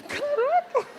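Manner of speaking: high, amazed exclamation; surprised. Acted. A believer arriving in heaven, amazed. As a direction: gasp in astonishment.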